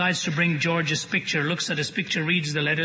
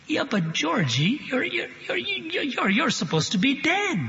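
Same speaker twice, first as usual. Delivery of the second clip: high, faltering stammer, breaking on each "you're"; can't get the words out for fright; stammering in shock; 9 semitones higher than usual. Retold or imitated. Mother stuttering at the son believed dead, high and shaken.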